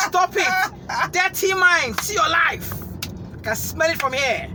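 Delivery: loud, high-pitched playful telling-off; hammed-up outraged yelling; mock scolding. Mock scolding after crude joke.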